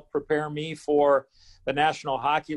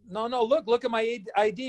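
higher-pitched retold plea; pleading protest; 5 semitones higher than usual. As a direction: urge him to check, a touch desperate. Pleads with second gatekeeper.

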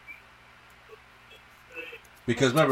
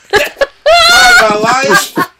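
screamed much higher and louder through a laugh; laughing shout; 16 dB louder, much slower than usual. Real, not acted. Cohost cries out to Prime, laughing, in a high shriek. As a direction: cry out his name in delighted disbelief. Cry-laughing over a movie moment.

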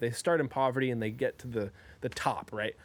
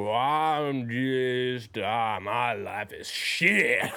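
very slow, mopey lowered voice; Eeyore impression; 4 semitones lower, much slower than usual. Eeyore complaining about his life, very slow and mopey. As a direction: drag it out like gloomy Eeyore. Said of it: "the Eeyore".

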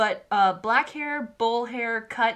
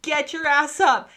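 higher and louder, a scolding mock yell; hammed-up nagging shout, sarcastic then demanding; 7 semitones higher, 7 dB louder than usual. Gabby's audio yelling at Reiner to wake up, loud mock scold. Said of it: "there's an audio that's like".

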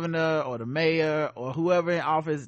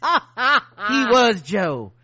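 put-on drunken posh sneer; 2 semitones higher, 7 dB louder than usual.

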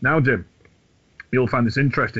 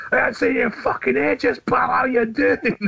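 friendly Glaswegian greeting; 13 semitones higher than usual. Retold or imitated. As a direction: greet an old mate like a Glaswegian bloke.

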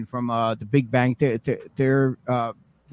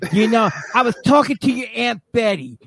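Yonkers mom; 8 semitones higher than usual. Pretend.

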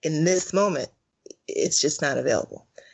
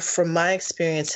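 usual speaking voice

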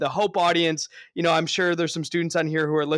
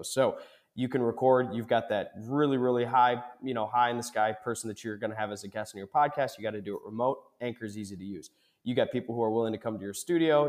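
smooth, steady sponsor read, softer than his chat; 3 semitones lower, 6 dB quieter than usual. Acted. Host reading a sponsor spot to listeners, upbeat ad read. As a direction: sell it like a friendly host ad spot.

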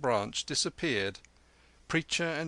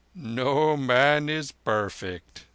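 slow, loud, drawn-out lama voice; grave lama; 3 semitones higher, 7 dB louder, much slower than usual. Acted. Lama excusing himself to the soldier, slow and grave. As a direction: pronounce it slowly and gravely. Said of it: "said the lama gravely".

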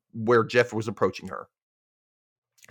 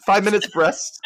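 high, loud and gleeful; mock-excited high voice; eager echo; 8 semitones higher, 5 dB louder than usual. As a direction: parrot it excitedly, near laughing. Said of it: "he does the same thing back".

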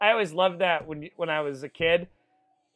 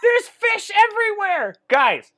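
high, loud excited shouting; excited yelling; 8 dB louder than usual. Real, not acted. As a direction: yell it like chaos just broke out. Excited narration of wrestling chaos.

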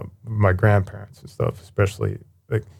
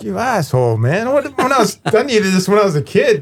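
mock exasperated rebuke; 8 semitones higher than usual. Retold mock anger at granddad.

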